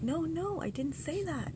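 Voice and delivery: high, urgent no no; alarmed denial. Retold or imitated. Denying to the group, high and alarmed. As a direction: protest fast and high, rattled. Frantic denial to upset friends.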